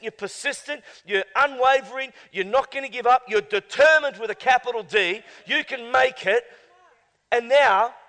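loud, high fervent exhortation; full-force preaching. Acted. Preacher rousing the congregation, loud and high. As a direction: rouse the whole room.

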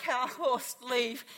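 ordinary talk, nothing marked

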